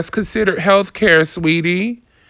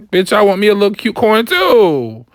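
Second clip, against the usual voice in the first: usual speaking voice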